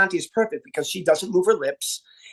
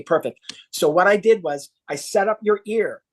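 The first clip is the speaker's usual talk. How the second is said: ordinary delivery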